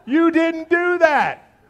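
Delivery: booming laughing slow punchline. Real, not acted. Punchline after elevator bit.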